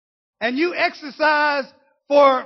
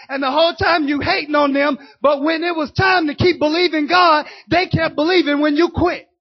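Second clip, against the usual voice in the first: preaching at full force; 3 semitones higher, 4 dB louder than usual. Acted. Preacher calls out the churchgoers who quit, loud and driving.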